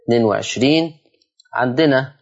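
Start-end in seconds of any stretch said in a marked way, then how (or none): none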